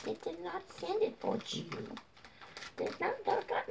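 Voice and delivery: high, soft apology to her dog. Real, not acted. Telling her dog no treats.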